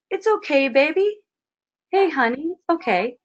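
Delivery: calm her down gently; comforting an irritated wife, tenderly; soothing wife. Pretend.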